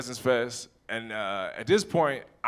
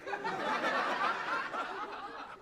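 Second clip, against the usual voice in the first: mocking laugh; 10 dB quieter than usual.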